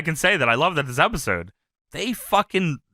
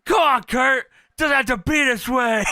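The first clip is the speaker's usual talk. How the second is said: raised, higher put-on voice; imitated wrestler pleading; 7 semitones higher than usual. Imitating a wrestler calming his boss down, hammed-up plea.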